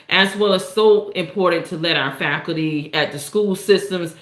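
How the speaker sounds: ordinary delivery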